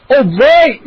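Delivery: loud, high whoop; exclaiming. A narrator's reading. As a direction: cheer in triumph. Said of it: "Moose exclaimed".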